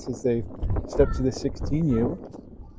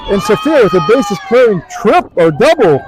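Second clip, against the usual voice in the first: high, loud big-hit call; thrilled sportscaster, raised voice; excited play-by-play; 7 semitones higher, 8 dB louder than usual. Real, not acted.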